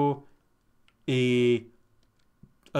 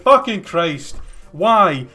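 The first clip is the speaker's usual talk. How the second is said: exasperated outburst; 4 semitones higher, 7 dB louder, much slower than usual. Retold or imitated. Retold fury at slow woman.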